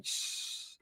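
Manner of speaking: unvoiced hushing shh; shush. Telling prima to come, quick unvoiced shh. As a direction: hush them with a soft shh.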